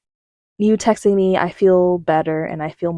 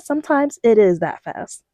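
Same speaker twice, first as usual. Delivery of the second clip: ordinary talk, nothing marked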